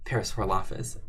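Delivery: usual speaking voice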